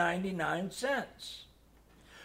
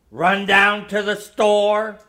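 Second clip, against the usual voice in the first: thundering; 10 dB louder than usual. A narrator's reading. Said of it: "my father thundered".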